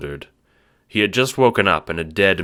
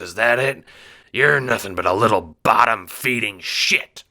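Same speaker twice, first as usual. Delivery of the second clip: louder, higher sneering threat; menacing bookie; 4 semitones higher, 5 dB louder than usual. Acted. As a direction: sneer it down at the little man.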